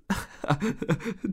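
bursting into giggles; burst of laughter. A narrator's reading. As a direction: laugh helplessly. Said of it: "brach in Gelächter aus". (lautes Loslachen)

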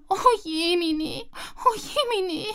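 high, drawn-out wail; slow lament. Acted. Squirrel wails slowly over her lost nuts. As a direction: moan it slowly.

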